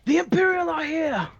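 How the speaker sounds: high-pitched mock scream, Australian accent. Game master screams for the pilot, high Australian accent. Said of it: "in your, an Australian accent".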